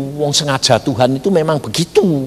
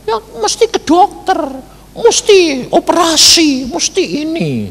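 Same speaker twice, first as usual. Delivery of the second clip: high-pitched mimicked nagging; imitated insisting relatives; 12 semitones higher than usual. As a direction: pester with advice in a raised pitch. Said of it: "saudara sudah bilang". (menirukan saudara mendesak, suara tinggi)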